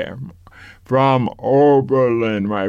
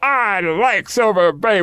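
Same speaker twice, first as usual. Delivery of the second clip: slow, higher Southern drawl, lightly imitated; lightly voiced Southern accent; 8 semitones higher than usual. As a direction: mimic the Southern visitor. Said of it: "strong Southern accent".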